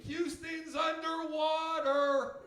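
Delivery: high, slow, loud fake-shocked cry; mock alarm. Comic mocking Americans faking grief over disasters. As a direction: wail it like fake outrage.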